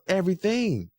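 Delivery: ordinary talk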